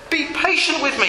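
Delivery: pleading, pitched high; begging. A narrator's reading. Servant to his master, begging. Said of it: "he begged".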